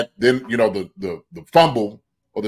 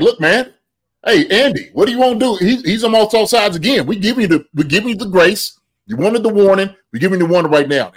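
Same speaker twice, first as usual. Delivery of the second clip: higher-pitched mock referee warning; imitating referee; 6 semitones higher than usual. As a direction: play the ref laying down the law. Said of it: "the referee said something like".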